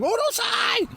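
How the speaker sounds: shrill high yell of urusai. Retold or imitated. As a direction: yell at the crying woman outside. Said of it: "and he's yelling".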